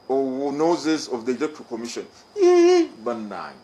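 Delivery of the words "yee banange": dismayed exclamation. Real, not acted. Exasperated Luganda exclamation.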